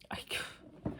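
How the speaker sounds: real tearful whisper that cuts out; very soft, breaking off; choked up. Real, not acted. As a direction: lose your voice to tears mid-word. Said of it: "I didn't mean to cry".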